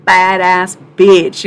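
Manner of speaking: ordinary talk, nothing marked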